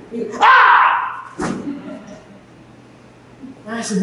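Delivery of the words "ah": high breathy yelp of pain; sharp cry of pain. Retold or imitated. Boy hurt by the pitch, high pained yelp. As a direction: let out a quick hurt yelp.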